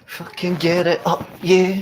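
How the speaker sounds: high, slow gloating cheer; celebrating. Real, not acted. Fan cheering Germany's opening goal, gloating.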